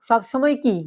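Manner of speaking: ordinary delivery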